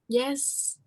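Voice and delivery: ordinary talk, nothing marked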